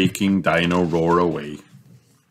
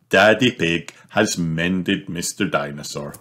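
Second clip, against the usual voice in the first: ordinary delivery, nothing marked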